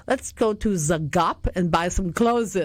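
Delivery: slow mock Swedish-accented English. Teller quoting her student's Swedish friend, slow Swedish accent. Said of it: "her Swedish friend would say".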